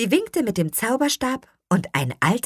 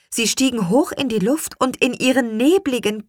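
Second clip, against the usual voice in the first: usual speaking voice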